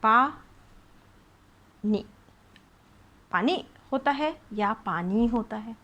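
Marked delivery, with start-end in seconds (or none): 0.0-2.1 पा नी split apart, पा loud; syllable by syllable. Shown as a demonstration. Teacher sounds out a word for pupils: पा नी. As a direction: sound out पा, pause, then नी. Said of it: "आप इसको बोल के देखिए".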